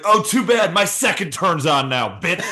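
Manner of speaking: high, loud, laughing gloat; gloating taunt. Pretend. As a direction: rub it in with a shouted laugh. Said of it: "And you're like".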